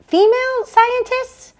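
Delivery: very high, slow astonished question; surprised. Acted. Joan hears sister's news, stunned and disbelieving. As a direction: stretch it out in disbelief.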